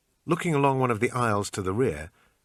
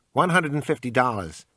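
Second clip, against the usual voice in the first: pawn shop salesman; 4 semitones higher, 5 dB louder than usual. Acted. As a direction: sell it like a pushy clerk. Shopkeeper haggling.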